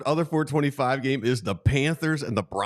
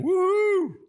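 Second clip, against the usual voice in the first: excited whoop. Real, not acted. Cheering the Sixers news with a high whoop. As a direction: whoop for the team like a fan.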